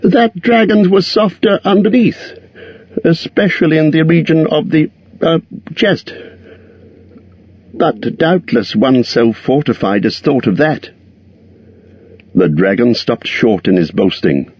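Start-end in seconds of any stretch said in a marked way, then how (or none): 0.0-10.9 high, timid hobbit squeak; frightened squeak. A narrator's reading. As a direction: squeak it out in fear. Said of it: "in a frightened squeak".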